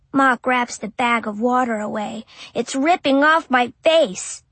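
plain reading voice

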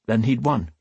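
plain reading voice

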